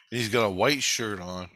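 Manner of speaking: normal talking voice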